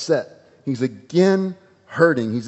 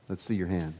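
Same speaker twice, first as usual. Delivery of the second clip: much softer, lower, gentle invitation; gentle hush; 7 semitones lower, 13 dB quieter than usual. Acted. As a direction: say it softly, like calming someone. Jesus gently speaks to man.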